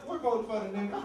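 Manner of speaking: put-on old lady relaying husband's answer; old lady repeating. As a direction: parrot it back in her voice. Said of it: "And she goes".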